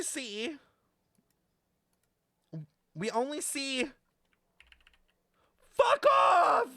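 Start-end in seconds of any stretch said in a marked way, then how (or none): 5.7-6.7 high, loud yell; sudden shout. Real, not acted. Streamer yelling at chat mid-explanation, high loud shout. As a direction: bark it at the chat.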